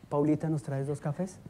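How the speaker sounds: sweet gentle request. Retold or imitated. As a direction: ask kindly like a different man entirely. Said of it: "Entonces no habla así".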